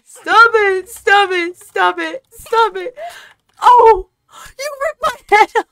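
high loud shocked cry; real shock at losing; real alarmed exclamation; outraged cry. Real, not acted. Friend cries out at death.

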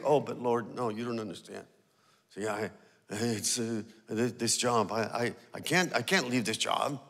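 low, halting plea to God; pleading excuse. Retold or imitated. Reluctant believer making excuses to God, low and halting. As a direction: stammer out an excuse to the Lord.

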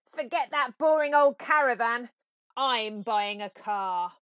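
loud eager voice, slowing at the end; smitten Toad. A narrator's reading. Toad tells his friends loudly and eagerly he is buying a car. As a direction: announce the new passion grandly.

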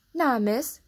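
a touch of fear in a quick no; startled, nervous maid; scared cry. A narrator's reading. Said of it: "cried Martha, looking scared".

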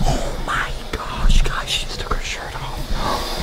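slow, drawn-out whisper; shocked whisper. Real, not acted. Boyfriend spying on girlfriend reacts to viewers, whispering. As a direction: whisper your shock from hiding.

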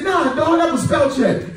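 pleading excuse. Retold or imitated. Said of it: "I said".

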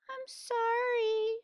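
high, slow little apology; sorry. Acted. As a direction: say sorry like a small child. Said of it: "the girl said".

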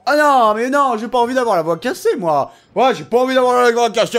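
loud, laughing complaint about his voice; laughing protest. Real, not acted. Player laughing about his sore, cracking voice. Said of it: "j'ai vraiment la voix qui est".

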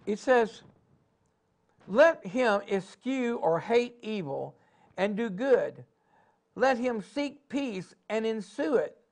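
rising, pressing preacher cadence; preaching. Acted. Preacher rallying his congregation, in full sermon cadence.

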